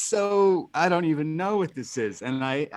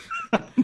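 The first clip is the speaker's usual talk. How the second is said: burst of laughter. Real, not acted. Cohost cracking up.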